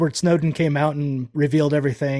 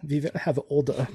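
lisping mock German voice; German accent with lisp; 5 dB quieter than usual. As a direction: play a stern German with a lisp. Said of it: "my German accent has a lisp".

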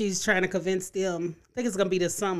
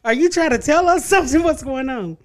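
louder, higher retold question; animated mock suspicion, pitched up; amused incredulity; 8 semitones higher, 8 dB louder than usual. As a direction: ask it in laughing disbelief. Retold incredulous joke question, laughing.

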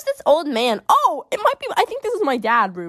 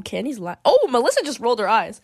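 ordinary delivery

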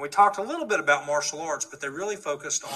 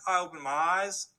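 ordinary talk, nothing marked

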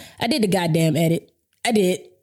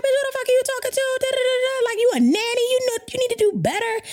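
high-pitched, rapid retold tirade; going off; faster than usual. Expectant mother telling off rude client, going off. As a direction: re-enact an indignant rant way up high. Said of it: "So I went the fuck off".

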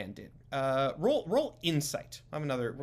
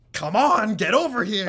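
loud, higher call to come closer; beckoning shout; 6 semitones higher, 7 dB louder than usual. Acted. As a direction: shout him over, forcefully.

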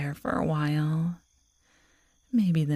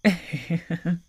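rising wicked giggle; teasing laugh. Acted. Werewolf girl catching her lover's excitement, rising wicked giggle.

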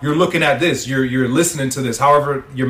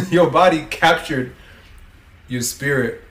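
ordinary delivery

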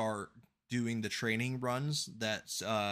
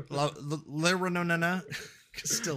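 flustered substitute teacher; 6 semitones higher, 4 dB louder than usual. Pretend. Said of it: "like the substitute teacher".

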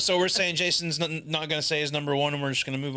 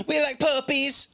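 silly voice; 10 semitones higher than usual. Acted. Silly high voice.

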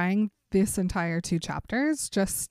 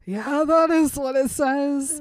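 mock Edward; 5 semitones higher, 6 dB louder than usual. Edward voiced, slow and loud.

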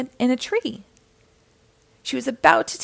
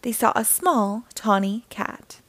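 plain reading voice

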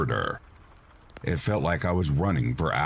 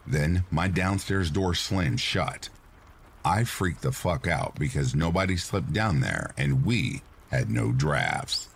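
ordinary delivery, nothing marked

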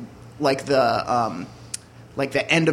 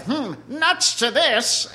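snooty Mister Peanut; 8 semitones higher, much slower than usual. Acted. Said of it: "is like".

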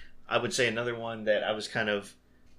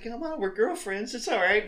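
mock reassuring; 8 semitones higher than usual. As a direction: reassure her like a fake best girlfriend.

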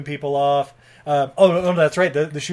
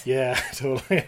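ordinary talk

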